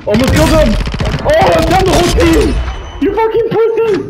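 high, loud yelling at teammates; excited gamer shout. Real, not acted. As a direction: yell it in the heat of the match, pitch way up. Shouting in excitement mid-game.

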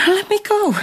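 sharp high cry to be let go; desperate plea. Acted. Shonagon pleading with Yukonari to release her, high cry. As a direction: pull away and cry out. Struggling to leave, held back.

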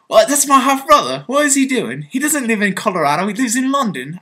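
high-pitched, incredulous mock outburst; astonished half-brother. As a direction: act out a baffled, indignant brother. Acts out astonished half-brother's reaction.

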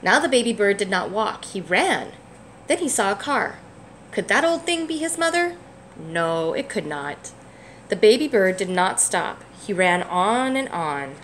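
gentle, lively picture-book read-aloud; reading to a child. A narrator's reading. Parent reading a picture book to a child, gently lively. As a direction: read it warmly for a little one.

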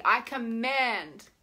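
slow, firm I command; deliberate, weighty command. Shown as a demonstration. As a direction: state it from the body, slowly. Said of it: "feel it in the body".

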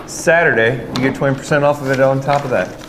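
ordinary talk

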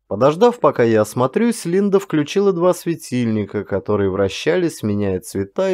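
ordinary delivery

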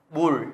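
"bul" ending on a curled-tongue L; curled-tongue final L. Shown as a demonstration. Teacher shows students another final L example, tongue curled. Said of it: "Các em sẽ uốn lưỡi lên nhá".

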